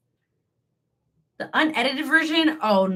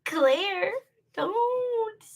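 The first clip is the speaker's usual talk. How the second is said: mock whimper; 9 semitones higher than usual. Mock cry at viewer Claire.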